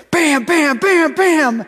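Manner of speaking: loud imitated bam bam on metal; banging noise. Toddler banging the rowboat, loud mock bam noises. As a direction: punch each bam like a toddler banging the boat.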